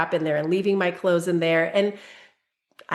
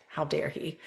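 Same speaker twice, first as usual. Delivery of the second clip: low, much softer mock huff under the breath; a huffy little aside, far quieter; muttered ego; 5 semitones lower, 10 dB quieter than usual. Mom's ego grumbling about her son's request, hushed huff. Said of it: "puffed up".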